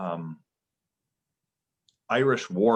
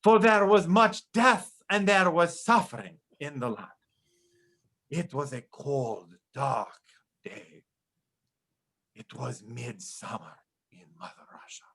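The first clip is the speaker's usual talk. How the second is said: put-on heavy Eastern European storyteller accent; hammed-up Hungarian storyteller voice; Magyar accent; 4 semitones higher than usual. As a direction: tell a grim folk tale in a thick Hungarian accent. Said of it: "you have to say Magyar".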